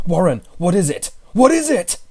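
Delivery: loud, rising panic; terrified. A narrator's reading. Narrator calling down to his friend, terrified. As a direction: beg for an answer, frightened. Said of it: "Terrified, I continued to repeat".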